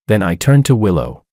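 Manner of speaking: usual speaking voice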